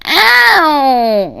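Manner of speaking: high whiny yelp of pain; whiny crybaby ow. Retold or imitated. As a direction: wail it like a crybaby. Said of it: "that whiny voice".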